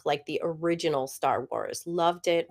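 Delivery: normal talking voice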